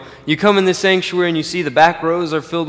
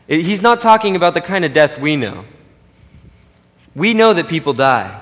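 ordinary talk

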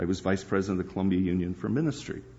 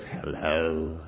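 low, soft ghostly hello; eerie answering-machine voice; 6 semitones lower, 4 dB quieter than usual. Retold or imitated. Answering machine greets the caller in a low, eerie voice. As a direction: sound like a spirit on a recorded line. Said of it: "this out-of-body person spirit that just says".

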